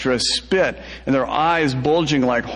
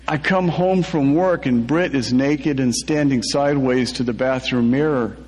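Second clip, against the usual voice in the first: usual speaking voice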